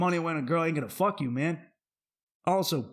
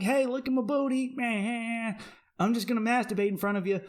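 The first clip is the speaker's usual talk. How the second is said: high mocking girly voice, faster at the end; mocking girl voice; 6 semitones higher, faster than usual. Comedian mocking OnlyFans girls, high girly parody voice. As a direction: sneer it as a vapid influencer. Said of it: "they're just like".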